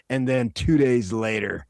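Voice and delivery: ordinary delivery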